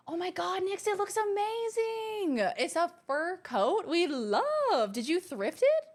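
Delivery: high, slow gushing squeal; high-pitched adoring gush; gushing delight. Real, not acted. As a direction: coo over the coat with delight. Gushing over a viewer's coat.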